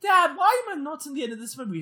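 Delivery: high-pitched mock kid voice; son asking Dad. Son asking Dad at the premiere, high plaintive question. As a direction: whine it up high like a puzzled son. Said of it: "and he goes".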